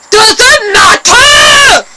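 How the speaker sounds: loud, high, very slow hammed-up shout; stretched, loud mock yell. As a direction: yell it like a hyper kid. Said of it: "He shouts when he's hyper".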